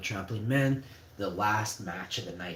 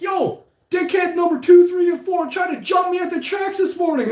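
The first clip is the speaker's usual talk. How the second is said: much higher and much louder call-out; calling them out; 13 dB louder than usual. Retold or imitated. Teen bursts into classrooms, loud angry call-out. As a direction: shout them out in front of the class.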